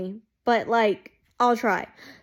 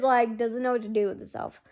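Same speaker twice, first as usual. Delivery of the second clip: ordinary talk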